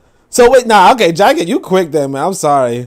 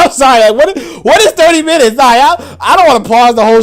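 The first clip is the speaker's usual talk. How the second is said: incredulous; 9 semitones higher, 6 dB louder than usual. Real, not acted. Incredulous at thirty minutes claim.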